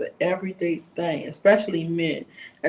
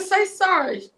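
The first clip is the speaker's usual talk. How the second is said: higher, louder second demand for sorry; shouted demand; 11 semitones higher, 5 dB louder, slower than usual. Retold or imitated. To the hallway girl who won't apologize, a raised angry demand. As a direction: snap it at her, losing patience. Repeated demand escalates the fight.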